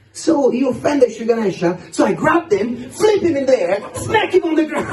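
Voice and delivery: put-on high kid voice climbing with each move; excited child recounting. Son telling dad how he beat the offender, excitedly boasting.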